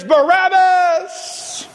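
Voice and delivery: very drawn-out, high mock crowd cry; crowd's scream. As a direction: stretch the name out high like a roaring mob. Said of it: "the people as they scream".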